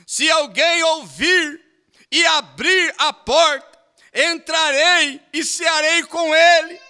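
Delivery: fervent preaching, high and loud; preaching at full force. Acted. Preacher urging the church to want the whole Bible, fervent preaching. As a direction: preach it to the congregation with fire.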